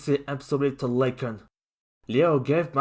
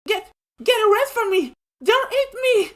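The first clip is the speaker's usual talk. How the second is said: very high, loud, fast terror; panicked shout; 8 dB louder than usual. A narrator's reading. Mabel panics and shouts at Mikey. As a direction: scream in panic.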